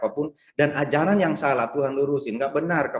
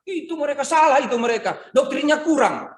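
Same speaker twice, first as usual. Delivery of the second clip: higher, indignant finger-pointing; mock outrage at the other church; sneering at their doctrine; angry accusation; 9 semitones higher, 4 dB louder than usual. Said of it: "Kalau kita mulai marah-marah".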